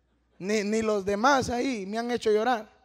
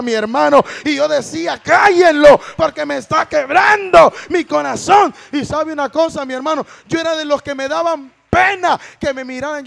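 loud, raised, impassioned sermon voice; full-force preaching; 4 semitones higher, 10 dB louder than usual. Acted. Testifying to the congregation about a broken heart, loud fervent preaching. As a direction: build to a shouted peak.

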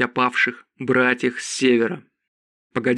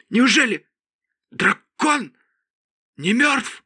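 high, halting question of shock; gasped-out disbelief, word by word, pitched high; 10 semitones higher, slower than usual. A narrator's reading. Donaiv learns the dragon may live, high shocked disbelief. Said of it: "Шокированный Донаив ответил".

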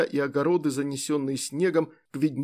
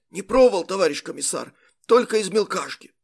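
louder, higher husky voice; hoarse voice; 4 semitones higher, 4 dB louder than usual. A narrator's reading. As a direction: rasp it out, strained throat. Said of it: "ответил сиплым голосом".

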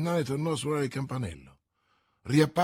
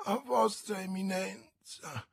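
softer, slow dazed murmur; a hint of stunned muttering; 7 semitones higher, 6 dB quieter, slower than usual. A narrator's reading. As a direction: mumble it with rolling eyes. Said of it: "mormorava Bonacieux".

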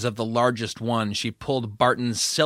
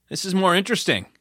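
playful offhand; 6 semitones higher, faster than usual. Acted. Sean's voice, playful.

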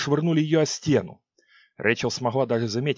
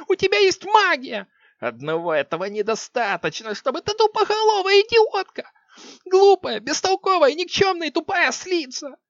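furious shriek; 4 dB louder than usual. Acted. Queen raging at witch.

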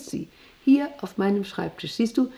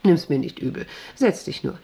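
usual speaking voice

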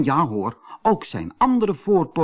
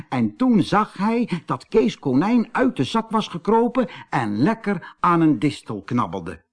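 ordinary delivery, nothing marked

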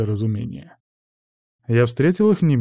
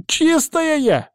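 very high, drawn-out outraged woman; slow, high-pitched furious slattern; enraged; much slower than usual. A narrator's reading. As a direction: screech indignantly at a slander. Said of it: "взъярилась шалава".

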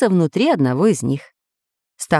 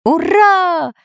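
loud, higher cheer; joyful shout; 10 semitones higher, 3 dB louder than usual. A narrator's reading. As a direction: burst out in joy. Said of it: "неожиданно закричал профессор".

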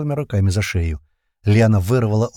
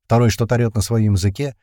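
ordinary delivery, nothing marked

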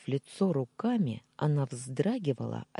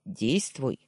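decisive. A narrator's reading. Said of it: "припечатал".

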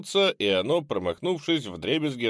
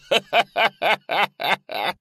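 high, loud cackling laugh; mocking cackle; 10 semitones higher, 7 dB louder than usual. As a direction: cackle at him. Ghoul laughing after taunt.

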